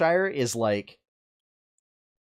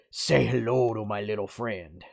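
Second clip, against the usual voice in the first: put-on Zul'jin quoted in a mobster-like, Cuban-tinged accent. Imitating troll Zul'jin for co-hosts, mobster accent. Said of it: "almost like mobster kind of accent".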